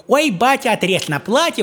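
a hint of a wheedling daughter; elder daughter. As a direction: ask daddy for the dress cloth, girlish. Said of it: "говорит".

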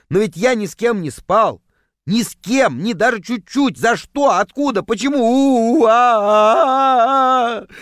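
panicked wail. A narrator's reading. Narrator bewailing his sudden, mysterious affliction, panicked wail. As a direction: plead frantically, then break into a drawn-out wail.

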